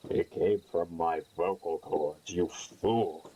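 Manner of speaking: haughty deep-voiced comeback; mock-solemn low retort. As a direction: answer grandly in a put-on brooding voice. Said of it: "I start talking like this".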